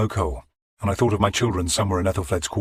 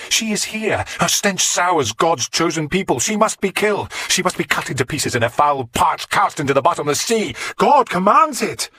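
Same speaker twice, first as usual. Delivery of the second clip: high, loud, frenzied preaching; raving; 12 semitones higher, 5 dB louder than usual. Acted. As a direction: rant like a possessed prophet. Mad monk raving at the king.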